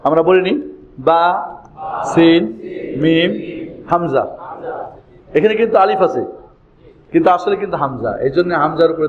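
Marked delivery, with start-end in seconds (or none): 1.0-4.2 spaced letter names with pauses; letter-by-letter drill. Shown as a demonstration. Teacher leading learners through the letters, slowly one by one. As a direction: say each letter separately, pausing for repetition.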